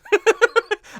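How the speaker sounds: short, high, loud giggle; amused giggle. Real, not acted. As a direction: burst into a quick high giggle. Reacting to the literal lip lyric.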